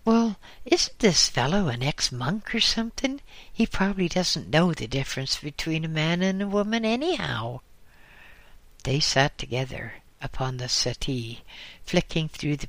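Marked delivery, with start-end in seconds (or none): 0.0-7.6 raised pitch, faster at the end; somewhat high voice. Acted. Justin answering Dennis about the monk, in a higher voice. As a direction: answer in a higher, slightly effeminate voice. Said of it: "replied in a somewhat high voice".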